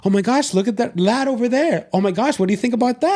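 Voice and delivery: lightly imitated chatter; mimicking chatty friend. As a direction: play the chatty friend who won't stop talking during the race. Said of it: "And you're like".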